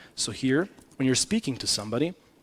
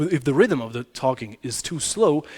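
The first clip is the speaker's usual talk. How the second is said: ordinary delivery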